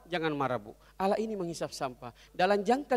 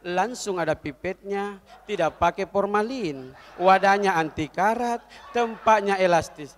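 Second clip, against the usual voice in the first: slow, measured proclamation growing louder; declaiming orator; 5 dB louder, slower than usual. Acted. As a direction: build each boast like a rally speech. Woman on stage proclaiming list of virtues. (pidato lantang, lambat dan makin menggebu)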